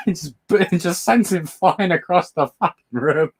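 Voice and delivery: high, giggly words through tears of laughter; crying with laughter; laughing while talking. Real, not acted. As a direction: push it out between laughs. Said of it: "I'm crying, guys".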